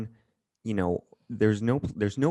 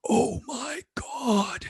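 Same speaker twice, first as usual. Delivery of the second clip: starstruck; much slower than usual. Retold or imitated. Starstruck fans mouths open.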